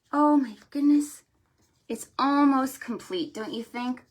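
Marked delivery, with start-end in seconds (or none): none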